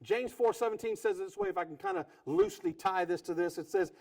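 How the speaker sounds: normal talking voice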